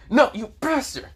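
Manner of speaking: very high, loud angry cry; hateful outburst. A narrator's reading. Valerie hating the time stop ability, hateful outburst. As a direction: shout it high through gritted teeth. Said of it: "she's gritting her teeth, literally hating".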